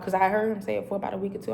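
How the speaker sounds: ordinary delivery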